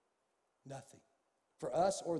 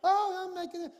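high, cheerful mom answering; a hint of a breezy mother; lightly voiced mom; 11 semitones higher, 4 dB louder than usual. Mom answering her son's hint, lightly higher voice. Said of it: "You know".

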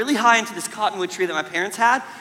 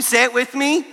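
normal talking voice

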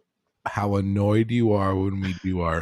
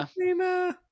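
squeaky high voice; 3 dB quieter than usual. Pretend. Mock greeting to wife.

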